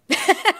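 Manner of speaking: loud high burst of laughter. Real, not acted. Laughing at her own self-criticism to the host. Laughs before quoting herself.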